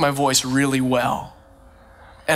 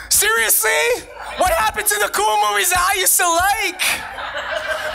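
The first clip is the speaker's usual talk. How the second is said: mock outrage. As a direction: playfully complain as if betrayed. Mocking Netflix full of K-dramas.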